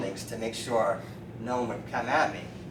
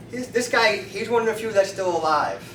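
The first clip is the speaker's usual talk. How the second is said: normal talking voice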